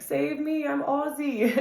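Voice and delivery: high mock [name] voice; imitating [name]. Fan quoting [name]'s clip in high mock voice. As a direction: play [name] pleading for laughs. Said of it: "He's like".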